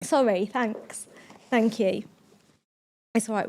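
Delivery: slow, teary thanks for a tissue; tearful. Real, not acted. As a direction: still choked up, taking the tissue.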